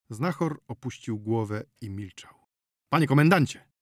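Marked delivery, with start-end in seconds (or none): 2.9-3.7 loud call to the commander. Acted. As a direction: call out over the room. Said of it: "zawołał lekarz". (głośne wołanie)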